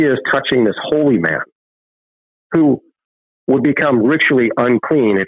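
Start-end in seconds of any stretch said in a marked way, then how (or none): none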